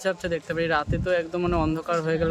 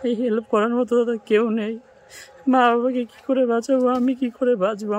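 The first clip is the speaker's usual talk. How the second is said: real distress, higher and louder; desperate cry for help; distressed plea; 6 semitones higher, 4 dB louder than usual. Real, not acted. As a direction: plead helplessly, voice raised in anguish. Pleading over family's helpless plight.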